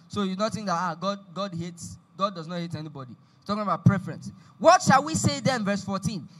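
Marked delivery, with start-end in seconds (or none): 4.6-5.6 loud, emphatic Bible reading; scripture read aloud. Acted. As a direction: proclaim the verses phrase by phrase, loudly. Reads scripture aloud to congregation.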